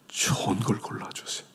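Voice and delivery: soft whispered reassurance. Real, not acted. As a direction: whisper it as comfort.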